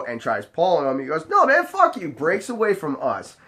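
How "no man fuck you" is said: very high-pitched mock protest; imitated outburst, pitched way up; acted angry refusal. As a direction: yelp it like a drunk breaking free. Drunk guy refuses and breaks away.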